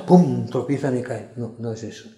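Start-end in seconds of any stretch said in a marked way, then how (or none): none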